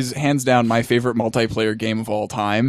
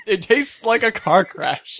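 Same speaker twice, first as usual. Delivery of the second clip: high, slow, laughing delivery; 9 semitones higher, slower than usual. Real, not acted. Co-host amused at the drink's taste, laughing as he talks. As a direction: repeat it through laughter.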